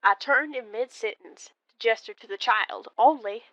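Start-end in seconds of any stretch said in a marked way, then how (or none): none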